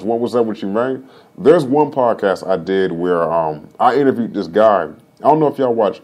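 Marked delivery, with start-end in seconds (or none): none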